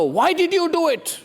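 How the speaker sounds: high-pitched, pointed accusation; emphatic, accusing demand; accusatory question. Acted. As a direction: throw the blame with the question. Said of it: "I just emphasize in my own".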